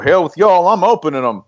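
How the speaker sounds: hammed-up defiant declaration; mock defiance. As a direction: play a stubborn guy brushing everyone off. Acting out defiant radio guy.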